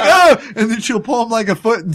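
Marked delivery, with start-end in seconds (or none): 0.0-0.3 high, loud yelp of pain; imitated pain cry. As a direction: yelp as if hit by a door. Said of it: "He's like".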